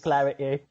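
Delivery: "clarity" with the T dropped; Cockney dropped T. Shown as a demonstration. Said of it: "that's Cockney one".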